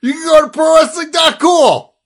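high, loud hype-man yelling; wrestling promo shout. Acted.

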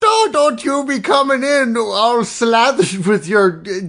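stern Irish nun telling off a drinker. Acted. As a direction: scold in a hammed-up Irish accent. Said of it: "why is she Irish".

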